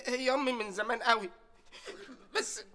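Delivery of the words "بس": rising, louder mock sob; comic wailing. Servant wailing to his boss, hammed-up sob. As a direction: wail it like a heartbroken child. Servant wails over mother's marriage.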